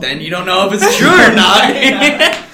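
loud, high-pitched comeback; playful protest. Real, not acted. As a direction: push back loudly over the laughter. Jokingly defends his claim over laughter.

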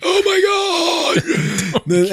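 high, drawn-out alarmed shout; shocked family cry. Retold or imitated. Family shrieking as he tosses the dog, alarmed cry. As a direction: cry out as if the dog's about to die. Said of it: "They were like".

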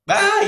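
high piercing scream of fright; retold scream. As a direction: shriek out in sudden panic. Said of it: "Y empieza a gritar".

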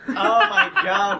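high, slow laughing exclamation. Real, not acted. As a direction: crack up as you say it. Laughing at joke.

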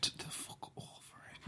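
very soft, voiceless aside; whispered retort. Real, not acted. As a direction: whisper it under your breath. Muttered retort under co-host's talk.

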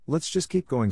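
mildly nervous, pitched high; stuttering nerves. A narrator's reading. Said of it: "stuttered Lorcan".

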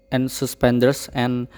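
ordinary delivery

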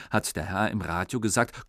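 ordinary delivery, nothing marked

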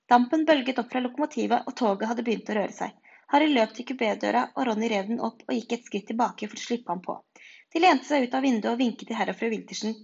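ordinary delivery

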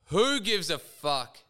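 re-enacted yell, high and loud, drawn out; urlo liberatorio rievocato. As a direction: let it rip like a yell of release. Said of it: "I just yelled out at the top of my lungs".

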